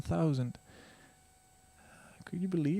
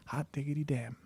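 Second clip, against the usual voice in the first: whispered in disbelief; awed whisper; 7 dB quieter than usual. Retold or imitated. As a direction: breathe it out in hushed awe. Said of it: "and I was just like".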